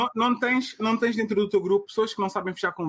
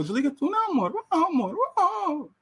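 high, cooing lovers' banter; giggly late night call; 9 semitones higher than usual. Retold or imitated. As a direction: coo at a partner on the phone. Mimicking couple's hang-up game.

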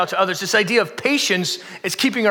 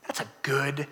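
hushed admiring verdict; 6 semitones lower, 8 dB quieter, slower than usual. Retold or imitated. Quoted fond verdict, hushed.

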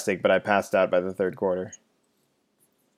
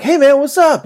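much higher, louder, chirpy hello; the freshman friend runs up calling out, high and excited; eager kid greeting; 7 dB louder than usual. Retold or imitated.

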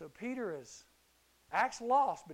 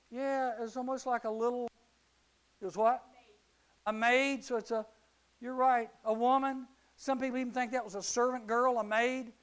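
usual speaking voice

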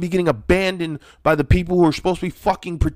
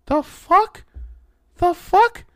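high, loud disbelieving squeak; shocked by what he just described; the fuck, said in outraged disbelief; incredulous; 5 dB louder, slower than usual. Real, not acted. Disbelief at absent parents.

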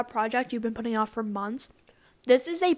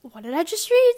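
confused; 7 semitones higher, 7 dB louder than usual. Retold or imitated. Reacting to confusing text.

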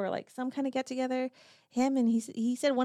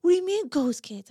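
higher, louder, incredulous; mock the boyfriend's disbelief, pitched up; alarmed boyfriend; 8 semitones higher, 8 dB louder than usual. Retelling the boyfriend's shock at ghost kids, higher and louder. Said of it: "he was just like".

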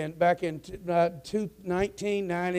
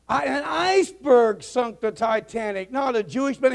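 acted protest; 6 semitones higher, 6 dB louder than usual. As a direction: argue back, spelling out the facts. Acted pilot argues back.

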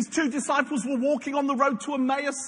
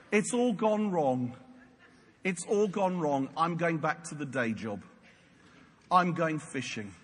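dejected Simon Peter; 10 semitones lower, 5 dB quieter than usual. Acted. Simon Peter tells the others he's quitting, dejected. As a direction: give up quietly, voice sinking low.

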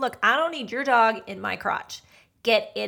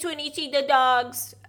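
mock dog talk; 4 semitones higher than usual. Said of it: "he talked like this".

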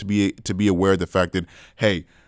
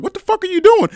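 high, loud retold outrage; outraged outburst; 5 dB louder than usual. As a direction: imitate yourself yelling in indignant shock. Retold confrontation with man kissing him.

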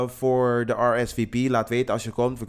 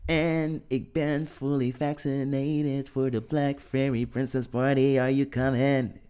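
slow, drawn-out, higher announcement; excited announcement; 4 semitones higher, much slower than usual. Real, not acted. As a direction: announce it like a party invite.

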